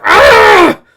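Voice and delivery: sudden piercing high scream; loud, drawn-out agonized cry; scream of pain. Acted. Professor struck by a sonic attack, scream of pain.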